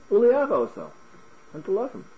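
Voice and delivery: high, offhand scripture reading; lightly dismissive, lifted pitch; no-big-deal reading. Shown as a demonstration. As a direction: toss it off high, as if it were easy. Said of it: "I read it as like".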